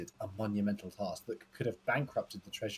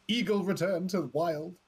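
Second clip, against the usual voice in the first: mock headline announcer; 8 semitones higher, 6 dB louder than usual. Reading a headline aloud.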